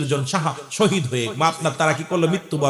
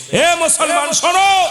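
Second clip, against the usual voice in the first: high, loud call to the faithful; preacher's shout; 8 dB louder, slower than usual. Real, not acted. Preacher summons the Muslims to listen, ringing shout. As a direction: cry out to make everyone listen.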